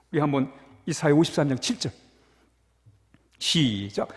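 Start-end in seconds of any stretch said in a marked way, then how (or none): none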